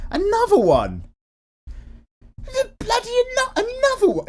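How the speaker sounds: very high, shouted hype-man catchphrase. Retold or imitated. A viewer asks for another tutorial and he hypes it with a shouted catchphrase. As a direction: belt it out like a DJ shouting another one. Meme shout on repeat request.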